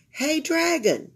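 ordinary delivery, nothing marked

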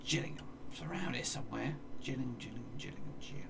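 ordinary talk, nothing marked

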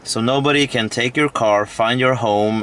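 ordinary talk

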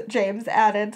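ordinary delivery, nothing marked

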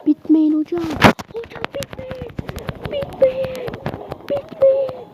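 very high, loud pleading shriek; desperate shriek. Real, not acted. Gamer shrieking as match timer runs out. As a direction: shriek for the clock to stop.

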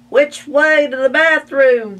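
high, loud demanding duck voice. Acted. Ducks demanding the bathroom from the boys, loud high. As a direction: demand it impatiently. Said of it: "they demanded".

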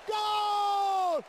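high, repeated ecstatic goal scream; breathless italian goal commentary. Real, not acted. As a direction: scream gol over and over.

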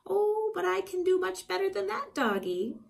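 high, eager witch tempting the dog; little witch voice. Acted. Zildy luring the dog with a fantastic promise, high and eager. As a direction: coax him with a grand offer. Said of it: "Zildy the witch speaking".

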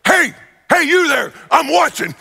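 high, loud call through the camera speaker; prank call-out. Retold or imitated. Pastor pranking wife on porch through camera speaker, loud high call. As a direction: startle her with a sudden booming hail. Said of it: "and I was like".

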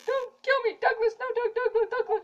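high, laughing shrieks of the name; laughing panic. Real, not acted. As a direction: shriek through giggles as he chases you. Screaming at Douglas while laughing.

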